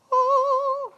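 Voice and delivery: high, thin "oh" of emotion; imitated happy cry. New parent as tears are flowing, high joyful whimper. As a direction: squeak out an overwhelmed little cry. Imitates tearful joy at baby's arrival.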